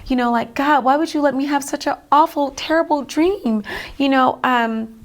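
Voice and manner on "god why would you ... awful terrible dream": higher-pitched plea to God; re-enacted anguished complaint; retold distress. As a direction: protest upward as if just woken from a nightmare. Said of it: "kicking and screaming like".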